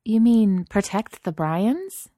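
slow, high, doubtful question; sceptical, lifting into a question. A narrator's reading. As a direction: draw it out in doubt. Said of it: "Chelsea said doubtfully".

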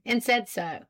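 ordinary delivery

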